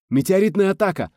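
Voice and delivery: loud, high cry of alarm; shout. A narrator's reading. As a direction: yell the warning over the radio. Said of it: "зачем-то крикнул селенолог". (тревожный крик)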